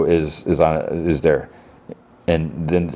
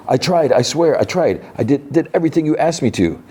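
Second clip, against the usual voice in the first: high-pitched mock desperation; desperate pleading; 9 semitones higher than usual. Boy defends himself to leader, high acted desperation. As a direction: insist in a high, acted fluster.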